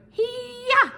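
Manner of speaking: karate yell. Acted. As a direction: chop the air with a playful kiai. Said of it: "and then you could go".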